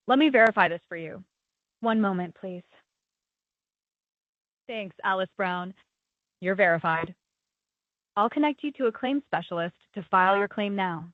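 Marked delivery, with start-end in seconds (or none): none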